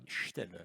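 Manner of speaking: Steller said with an initial SH, "Shteller"; soft, low Steller opening on a long SH; accented pronunciation. Shown as a demonstration. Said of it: "avec l'accent".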